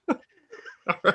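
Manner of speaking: high-pitched laugh through the words. Real, not acted. Narrator laughing at his own creepy reading. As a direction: crack up while saying it.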